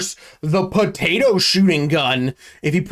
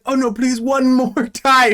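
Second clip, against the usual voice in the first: mock pleading; 8 semitones higher than usual. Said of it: "she's like".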